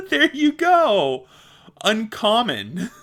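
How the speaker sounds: loud, high, drawn-out "Uncommon" through laughter; laughing triumph. Real, not acted. Podcaster finally getting a hard word out, laughing triumph. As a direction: crow "Uncommon" slowly, finally said right.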